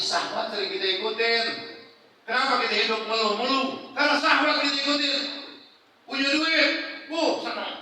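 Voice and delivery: high, loud preacher's punchline; fiery sermon climax; preaching at full force. Acted. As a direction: drive it home to the congregation.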